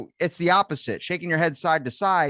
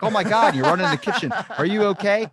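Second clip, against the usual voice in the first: mock panic; 7 semitones higher, 5 dB louder, faster than usual. Panicked reaction to misread text.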